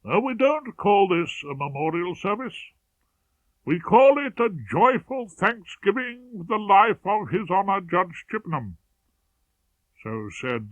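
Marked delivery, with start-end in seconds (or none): 0.0-8.8 high, bright-spirited clergyman voice, pitched up; raised, hearty church voice; cheerful vicar. Acted. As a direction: beam it out like a jolly parson greeting mourners. Vicar speaking at memorial.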